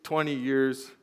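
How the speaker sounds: normal talking voice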